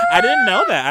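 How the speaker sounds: high squealing laugh through the words. Real, not acted. Laughing shock at the remark.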